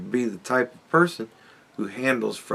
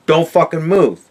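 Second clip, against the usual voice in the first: loud, higher-pitched warning; mock angry command; 5 semitones higher, 8 dB louder than usual. Streamer warning his dog as he sits down, mock angry command. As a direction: order the dog to freeze, loudly. Acting out owner ordering dog.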